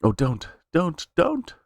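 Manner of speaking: sharp high cries of don't, rising to a shriek; high, slow, desperate pleading; pleading scream. Acted. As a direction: beg for it to stop, voice breaking upward. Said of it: "Then Linda screamed".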